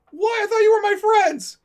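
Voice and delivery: very high, loud, wailing imitation; mock betrayed. Adrian confronting his prankster friends, mock betrayed wail.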